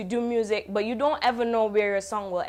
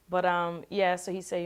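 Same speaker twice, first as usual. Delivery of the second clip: ordinary talk, nothing marked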